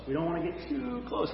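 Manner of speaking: a quiet echo, letting it sink in. Real, not acted. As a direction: drop to a near hush on the repeat. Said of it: "repeats softly".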